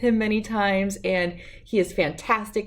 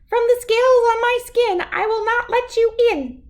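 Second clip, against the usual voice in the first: very high, louder squeaky fish voice; tiny fish voice; 5 dB louder than usual. A narrator's reading. Second little bass refusing the gar, very high squeak. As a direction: squeak it up fast and defiant.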